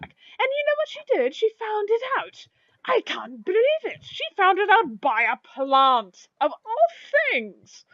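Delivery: high-pitched, incredulous excitement; giddy gossip. Acted. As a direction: squeal the astonishing news to a customer. Excited shopkeepers tell amazing gossip.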